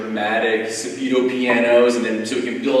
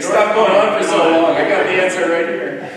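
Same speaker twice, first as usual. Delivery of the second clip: voicing the pianist's impatience, louder and higher; mock-impatient heckle, pitched up and loud; 8 semitones higher, 9 dB louder than usual. Lecturer jokingly speaks for the pianist eager to answer, mock impatient. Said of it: "he's gonna give me the answer".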